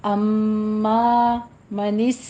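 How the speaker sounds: मनिस ending in a whistling स; tajweed demo. Shown as a demonstration. Teacher shows the whistling sin. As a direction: hiss the स of मनिस. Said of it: "सीटी की आवाज़ आएगी".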